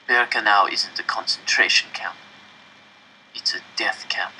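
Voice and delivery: hushed, fading whisper; grim whisper. A narrator's reading. Old man revealing the death camp, whispered. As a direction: whisper the truth darkly.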